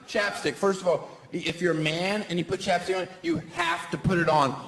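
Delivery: normal talking voice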